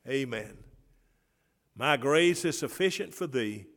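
high, loud call to the congregation; rally the church to believe; preaching at full force. Acted. Pastor pressing the congregation for an amen, loud preaching.

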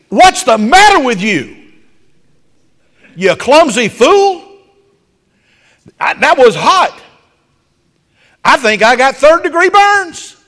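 loud, high furious yell at his wife; angry scream. Retold or imitated. Husband yelling at his clumsy wife, furious and loud. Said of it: "And he screamed".

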